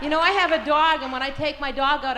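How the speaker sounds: normal talking voice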